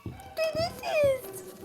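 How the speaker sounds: very high, slow put-on whine; squeaky whine. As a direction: stretch it out in a squeaky voice. Reacting to the game.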